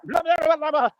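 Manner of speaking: high babbling blah-blah imitation; Anchorman news reporter. Streamer imitating someone talking over him, high babbling mockery. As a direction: burst into high mock gibberish. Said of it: "like the news reporter on Anchorman".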